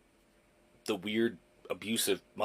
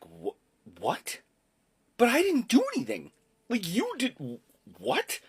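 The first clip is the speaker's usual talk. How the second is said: high, incredulous disbelief; stunned protest; 10 semitones higher than usual. Retold or imitated. Son hit with unfair punishment by mom, high incredulous protest. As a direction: protest like a wronged kid. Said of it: "And I was like".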